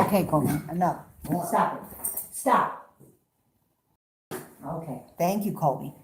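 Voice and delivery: settling the dog. Real, not acted. Calming the dog after scolding.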